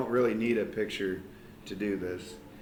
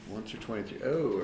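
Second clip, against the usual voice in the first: usual speaking voice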